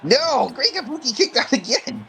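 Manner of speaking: very high, exclaimed match call; excited disbelief. Real, not acted. Watching Great Kabuki kick out of the pin, excited disbelief.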